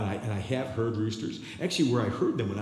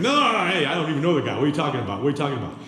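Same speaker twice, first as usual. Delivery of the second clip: loud, high, flustered denial; high loud protest of innocence; Peter's loud denial; 3 semitones higher, 6 dB louder, much faster than usual. Acted. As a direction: brush her off loudly, panicking. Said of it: "He's like".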